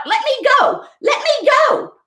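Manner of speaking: very high frantic squeal; squealing piglet. Acted. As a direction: squeal it, struggling to get free. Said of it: "kicked and squealed and fought".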